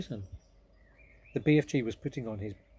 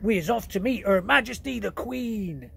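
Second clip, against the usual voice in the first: much higher, louder giant voice; excited giant; 9 semitones higher, 7 dB louder than usual. Acted. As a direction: announce it eagerly. BFG excited.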